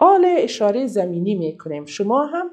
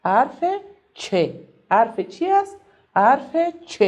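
normal talking voice

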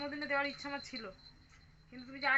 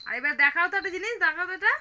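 excited; 4 semitones higher, 12 dB louder than usual. Real, not acted. Parent excitedly showing toddler gift.

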